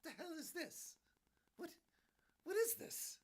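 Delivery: high, incredulous disbelief; baffled outburst. Retold or imitated. Fan seeing the crystal skull, high incredulous disbelief. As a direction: squeak out total disbelief. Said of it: "I went".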